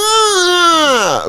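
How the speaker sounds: high, whiny yelp; whiny spazz cry. Retold or imitated.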